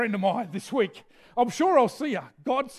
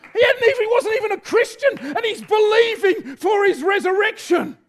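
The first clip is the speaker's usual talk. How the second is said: much higher and louder, rising fervour; excited preaching; 8 semitones higher, 5 dB louder than usual. Real, not acted. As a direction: lift the church with rising fervour. Preaching climax.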